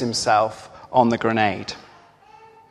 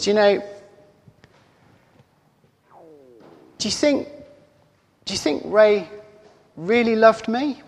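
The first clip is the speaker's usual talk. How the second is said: higher-pitched, hesitant asking with long pauses; unsure, searching plea; tentative question; 7 semitones higher than usual. Acted. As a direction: ask it haltingly, pitched up, rising at the end. Acted question to grieving mother.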